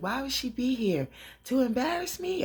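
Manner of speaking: high, rising indignant question; acted indignation. [name] questions Angela's presence, high-pitched mock indignation.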